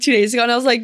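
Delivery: ordinary delivery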